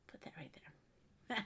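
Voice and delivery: whispered aside to herself. Real, not acted. Crafter placing an item, whispering to herself. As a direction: breathe it without voice, just to yourself. Aside while arranging items.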